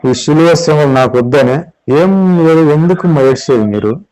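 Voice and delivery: normal talking voice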